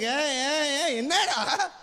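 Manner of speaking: hammed-up judgmental pharmacist; judgmental call. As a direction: hail him like a scandalised shopkeeper.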